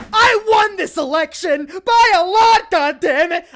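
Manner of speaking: over-the-top Trump tantrum impression; Trump whining. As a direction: squeal it like a sore loser who won't admit it. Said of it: "Trump's tweet when he goes".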